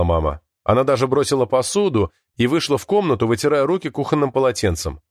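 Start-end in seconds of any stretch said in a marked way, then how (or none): none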